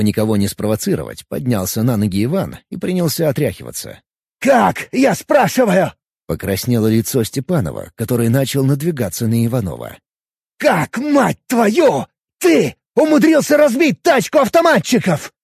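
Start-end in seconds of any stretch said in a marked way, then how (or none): 4.4-5.9 loud, slow furious demand; angry demand. Acted. Angry officer interrogating. 10.6-15.3 very slow, high, loud angry yell; furious yell. Acted. Angry officer interrogating.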